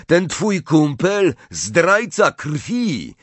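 high, slow jeer; mocking. A narrator's reading. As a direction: draw out the insult to taunt him. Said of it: "rozległ się drwiący głos".

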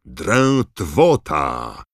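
loud, slow, drawn-out incantation; spell called in chorus. A narrator's reading. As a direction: cast the spell with full force. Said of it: "zawołali chórem".

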